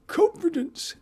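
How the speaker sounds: very high, mock-shouted old gent; funny old-man voice. Psychic imitates an old gentleman's shout, high funny voice. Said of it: "And a funny voice as well".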